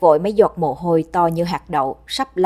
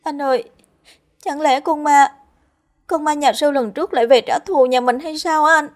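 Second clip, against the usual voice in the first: much higher tearful pleading; sobbing; 10 semitones higher, 3 dB louder than usual. Acted. Kim weeping in her brother's arms, fearing revenge. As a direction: weep into your brother's arms. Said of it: "mà khóc nức nở".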